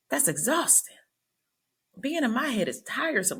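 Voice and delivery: high-pitched comic self-interrogation; exasperated rant. Acted. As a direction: play up being worn out by your own head, voice up high. Comic rant about her own busy head.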